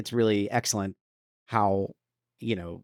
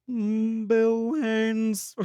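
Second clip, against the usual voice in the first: high-pitched cartoon voice; high silly voice; 12 semitones higher, much slower than usual. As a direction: pipe the name up high. Silly patron voice.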